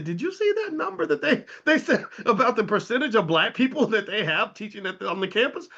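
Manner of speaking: a woman who can't believe the numbers; incredulous colleague. Retold or imitated. Host voicing a fellow professor on the phone, amused disbelief. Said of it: "She said".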